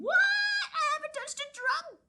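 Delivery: high indignant slurred protest; indignant drunk denial. Acted. Reporter protesting to the night editor, tipsy and indignant. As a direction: protest too much, tipsy. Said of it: "says I".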